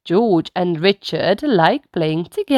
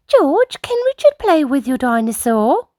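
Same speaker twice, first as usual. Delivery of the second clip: higher, gently asking mother; Mummy Pig asking; 8 semitones higher than usual. Acted. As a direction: ask her son nicely to share. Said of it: "asks Mummy Pig".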